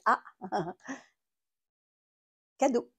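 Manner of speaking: normal talking voice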